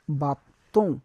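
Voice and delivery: bateau said ba-to, final letters silent; French bateau. Shown as a demonstration. Teacher modelling after spelling for class, French bateau. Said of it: "we say bateau".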